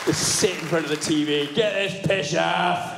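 put-on Scottish old man telling off the telly, slowly; grumpy old Scot. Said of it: "adopt a Scottish accent".

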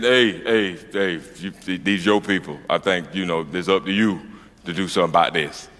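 low, quiet voice of the whiskey talking; Jack Daniels. Acted. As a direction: coax him quietly, pitched well down. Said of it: "all that Jack Daniels I was drinking said".

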